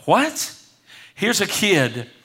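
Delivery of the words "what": high, rising one-word astonishment; mock astonishment. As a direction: imitate a baffled crowd, pitched up. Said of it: "they were all like".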